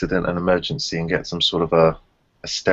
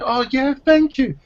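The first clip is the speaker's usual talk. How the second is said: very high, airy mock gratitude; mimicking the mother accepting help; mock woman's thanks; 4 dB louder than usual. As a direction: squeak out a sugary thank-you.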